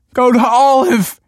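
high, loud, drawn-out desperate yell; begging. A narrator's reading. Man sending friend away from explosion, begging yell. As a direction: beg at the top of your voice. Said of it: "he begged".

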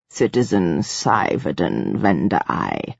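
deep, unhurried voice of authority; imperious ruler. A narrator's reading. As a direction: address them gravely, drawing out each word. Narrator voices the ruler Mia Na'ai.

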